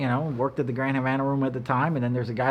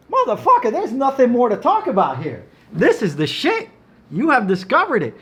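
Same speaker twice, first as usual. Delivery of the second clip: much higher, louder, rapturous insistence; fired up; 9 semitones higher, 5 dB louder than usual. Real, not acted. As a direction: sell it with full conviction. Excited pitch about his cigars.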